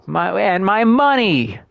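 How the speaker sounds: high, slow, mock-wailing over wife and money; mocking whiny nobleman. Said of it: "he's like, / I'm making fun of this".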